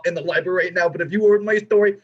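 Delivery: ordinary talk, nothing marked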